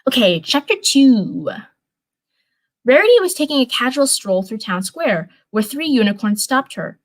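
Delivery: normal talking voice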